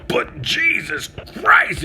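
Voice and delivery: shouted exasperation; exasperated shout. Real, not acted. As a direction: throw the words up in frustration. Climax of the mask rant.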